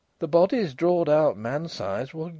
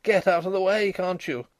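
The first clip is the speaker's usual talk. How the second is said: higher, louder shout to clear the path; narrated shout, raised in pitch; 6 semitones higher, 4 dB louder than usual. A narrator's reading. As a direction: shout it impatiently at someone blocking you.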